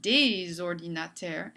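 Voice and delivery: des ordinateurs with a Z sliding into the vowel, slowly; liaison. Shown as a demonstration. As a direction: link des into ordinateurs with a Z sound. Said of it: "make this word slide into each other".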